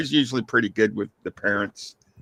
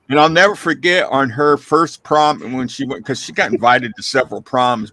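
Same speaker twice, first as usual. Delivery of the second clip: normal talking voice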